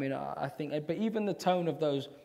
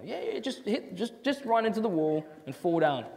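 mock breezy director; 9 semitones higher than usual. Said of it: "Well, he's not smiling".

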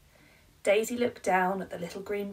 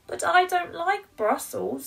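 mock wobbly mum; 11 semitones higher, 8 dB louder than usual. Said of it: "lip began to wobble".

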